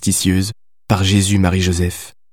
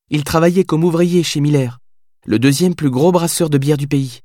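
higher, quick-fire answers; young woman's voice; 7 semitones higher than usual. A narrator's reading. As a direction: reel off the facts without hesitation. Heroine answers rapid-fire.

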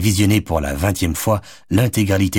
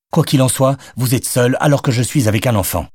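irritated rebuttal; 6 semitones higher than usual. A narrator's reading. Arguing over the table.

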